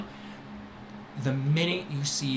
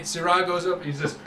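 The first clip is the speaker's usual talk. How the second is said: ordinary delivery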